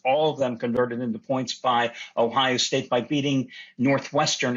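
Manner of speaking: ordinary delivery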